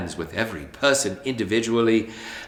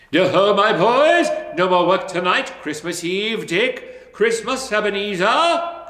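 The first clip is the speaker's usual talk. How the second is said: loud, high festive shout; jolly cry; 8 semitones higher, 4 dB louder than usual. A narrator's reading. Fezziwig calling the lads to stop work, loud and jolly. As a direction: announce the holiday to the lads. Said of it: "cried old Fezziwig".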